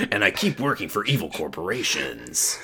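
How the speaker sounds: low, quiet brooding hero; mock game hero. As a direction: lightly play a grim action hero. Mock video game hero monologue.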